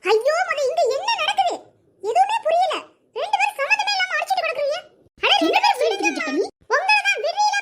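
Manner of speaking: high-pitched, agitated scolding; exasperated plea. Acted. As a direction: break up the fight, pitched well up. Bystander pleading to stop a fight. (உயர்ந்த குரலில் பதறிய கண்டிப்பு)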